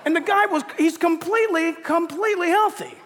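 loud, high, laughing at the punchline; excited punchline. Real, not acted. Pastor delivering the punchline to the congregation, loud and laughing. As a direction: burst out with the twist. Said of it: "pastor laughing at punchline".

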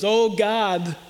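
slow, lightly acted appeal to heaven; a measured mock plea to God; imitating Tevye. Preacher quotes Tevye asking God for wealth, lightly imitated. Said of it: "He goes like this".

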